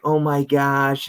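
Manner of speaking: high, slow cooing; gushing mock. Mocking people fussing over someone's age, slow cooing. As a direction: coo it like a fawning fan.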